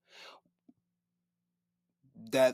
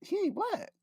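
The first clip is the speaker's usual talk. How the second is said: confused disbelief. Retold or imitated. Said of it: "I was like".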